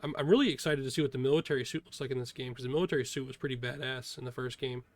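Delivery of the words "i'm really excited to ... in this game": usual speaking voice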